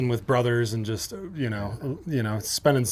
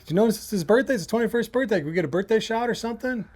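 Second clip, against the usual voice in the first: higher-pitched, hopeful asking; re-enacted cajoling of a bartender; retold eager request; 8 semitones higher, faster than usual. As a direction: ask high and keen for a birthday favor. Acted request for birthday shot.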